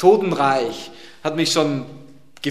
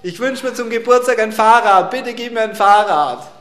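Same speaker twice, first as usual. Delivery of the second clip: put-on higher child voice calling loud to God; boy praying loudly for a bike at bedtime, higher and louder child voice; little boy shouting a prayer; 7 semitones higher, 4 dB louder than usual. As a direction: play a small boy yelling his bedtime prayer. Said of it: "ganz laut zum Herrn schreit".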